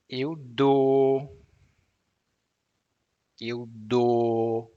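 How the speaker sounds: eu dou said slowly, dou as a steady O with no glide; Portuguese eu dou. Shown as a demonstration. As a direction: say dou holding the jaw still. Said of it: "See, doesn't move".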